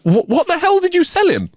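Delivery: very high-pitched, stammered disbelief; shocked, high-pitched outburst with a stutter on "what"; choked exclamation. Acted. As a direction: splutter it out as the stunned manager. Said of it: "The manager choked and exclaimed".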